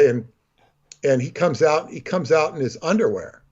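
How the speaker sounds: ordinary talk, nothing marked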